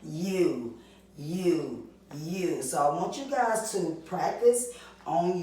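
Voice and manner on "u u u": "U" said as a clear long you sound three times. Shown as a demonstration. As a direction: model the long "U" for kids. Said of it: "long U make what sound".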